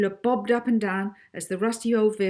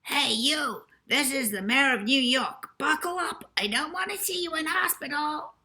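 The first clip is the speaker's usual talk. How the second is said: high, tinny recorded mayor shouting; a squeaky little voice box barking orders; tiny recorded voice; 8 semitones higher than usual. A narrator's reading. Recorded mayor orders passengers to buckle up, tiny shout. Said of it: "A tiny recorded voice ... shouted".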